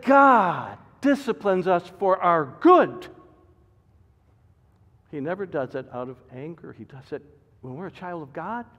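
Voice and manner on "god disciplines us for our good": loud, high, driving pulpit delivery; preaching at full force. Acted. Pastor warning his congregation, preaching at full force. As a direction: hammer the warning home from the pulpit.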